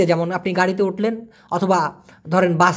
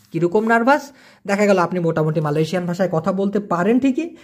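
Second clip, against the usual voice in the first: ordinary talk, nothing marked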